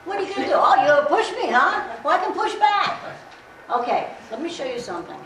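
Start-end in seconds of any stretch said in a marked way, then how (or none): 0.1-3.0 loud, higher play-acted taunts, laughing; mock taunting. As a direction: taunt them, half laughing. Role-play confrontation with student.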